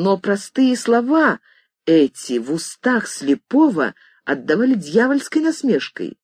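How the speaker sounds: usual speaking voice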